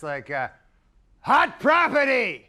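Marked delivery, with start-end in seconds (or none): 1.2-2.5 slow, loud, high showman bellow; Ed McMahon announcing. Retold or imitated. Ed McMahon introducing the junior dancers, slow booming announcer. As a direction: stretch it out like a game-show host selling the act. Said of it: "he always has this".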